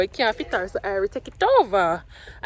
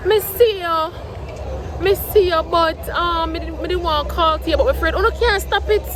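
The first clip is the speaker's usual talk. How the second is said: a higher, louder imitation of an eager fan calling out; lightly voiced excited fan, pitch raised; excited commenter; 6 semitones higher, 4 dB louder than usual. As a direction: ham up the fan who saw her on the road. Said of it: "in the comments and me post the vlog and say".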